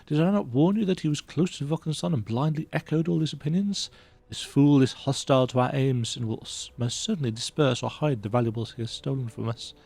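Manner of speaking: dramatic letter reading. Acted. Streamer reading a betrayed count's letter aloud, hammed-up outrage. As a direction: sell the outrage of the letter, then let it sink to fear.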